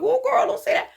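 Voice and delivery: high, mock-scolding friend voice; imitating friends. Mimicking people who scold her, high and lightly scolding. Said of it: "people are like".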